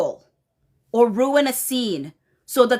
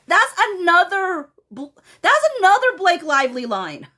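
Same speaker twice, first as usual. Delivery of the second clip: excited exclamation; 6 semitones higher than usual. Real, not acted. Host exclaims at another line.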